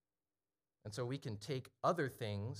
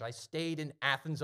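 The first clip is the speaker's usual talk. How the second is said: ordinary talk, nothing marked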